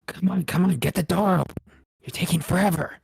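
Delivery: high, loud impatient goblin voice; bickering goblin. Acted. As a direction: hurry him up, nagging goblin. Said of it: "two voices bickering with each other".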